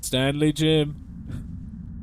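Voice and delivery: Stanley stretched out very slowly; slow name. Acted. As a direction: drag the name Stanley Jim out. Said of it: "I like that better".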